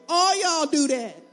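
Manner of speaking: slow, loud and pointed at the crowd; loud call-out. Real, not acted. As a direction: call them all out, slowly and loud. Preacher calling out the crowd.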